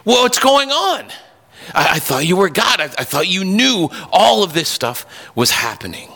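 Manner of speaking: high, incredulous plea to God; Habakkuk crying out. Retold or imitated. Prophet Habakkuk complains to God, high incredulous plea. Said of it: "He's crying out to God".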